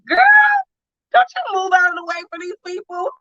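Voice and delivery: high, fast exasperated scolding; angry scolding. Retold or imitated. Mother retelling scolding her daughter, high and fast. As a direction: snap at her to stand firm. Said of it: "it pissed me off".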